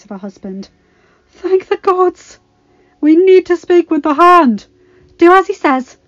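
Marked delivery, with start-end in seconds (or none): 1.3-4.7 higher, loud relieved cry; relieved urgent shout. A narrator's reading. Relieved arrival, urgent plea. 5.2-6.0 commanding. A narrator's reading. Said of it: "Sansa directed".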